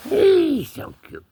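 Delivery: high-pitched, slow pet talk; high cooing. Pretend. Owner gushing over the cat, high slow cooing. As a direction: coo it slowly up high.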